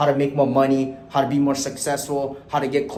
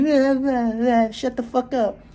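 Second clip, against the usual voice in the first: put-on high, whiny kid voice taunting; mocking bratty kid; 9 semitones higher, slower than usual. Mocking Fortnite kids telling him to shut up, high and whiny. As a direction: squeak it out like a rude little gamer. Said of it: "Let me go ahead and reenact".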